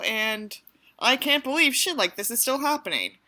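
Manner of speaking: normal talking voice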